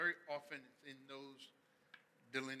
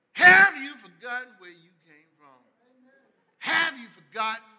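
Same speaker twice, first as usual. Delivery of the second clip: loud, high, fired-up preaching; loud animated sermon; full-force preaching; 10 semitones higher, 20 dB louder than usual. Acted. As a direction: preach it big and loud to the crowd.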